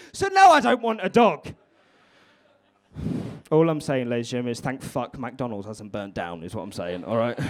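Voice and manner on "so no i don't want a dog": loud ranting punchline, pitched up; emphatic shout. Real, not acted. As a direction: bellow it at the crowd as the payoff.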